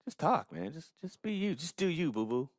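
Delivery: high playful encouraging voice; mock cheerleading friend. Voicing a listener telling the hosts to be themselves, high playful. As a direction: egg him on like a hyped buddy.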